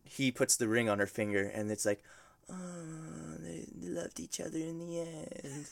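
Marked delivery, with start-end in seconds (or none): 2.5-5.7 very soft, higher, sappy coo; hushed, hammed-up sentimental sigh of a line; mock tender. Friend mocks the couple's ring scene ending, very soft mock tenderness.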